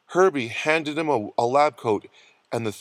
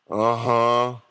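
weak mumbled assent; 2 semitones lower than usual. A narrator's reading. Sick Rembrandt answers the nurse, mumbling. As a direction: mumble it without opening up. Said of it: "mumbled Rembrandt".

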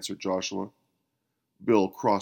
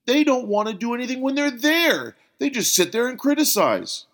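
high, loud indignant rant; standing, fuming protest; heated outburst; 14 semitones higher, 5 dB louder than usual. A narrator's reading. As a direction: rise up and vent. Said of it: "Bill stood to his feet".

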